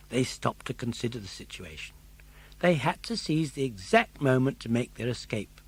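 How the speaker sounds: plain reading voice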